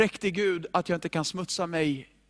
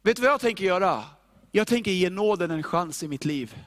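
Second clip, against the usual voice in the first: normal talking voice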